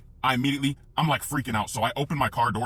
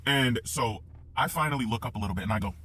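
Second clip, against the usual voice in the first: ordinary delivery, nothing marked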